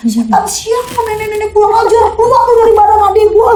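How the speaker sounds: very high angry shout; loud high furious rebuke; cursing the ghost. Retold or imitated. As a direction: scream at the spirit to leave. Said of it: "gue maki-maki".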